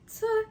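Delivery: sharp high scoffing "tuh". Retold or imitated. Bragging after surgery, a sharp high scoff. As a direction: scoff it off like it's nothing.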